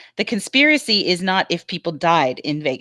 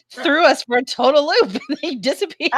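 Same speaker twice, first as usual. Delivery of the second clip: high, giggly retelling; words breaking into laughter; laughing; 9 semitones higher than usual. Real, not acted. Laughing about the dropped call.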